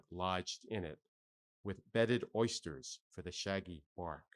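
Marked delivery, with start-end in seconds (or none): none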